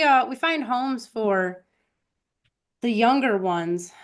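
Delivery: normal talking voice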